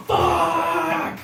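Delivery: high cursing yell of a deaf dad; mock yell. Dad kicking his burning car, a high mock yell.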